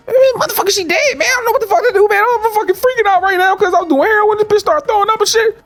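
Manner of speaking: frantic, very high-pitched blubbering; hammed-up crying. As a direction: wail in mock panic down the phone. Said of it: "Now, he crying".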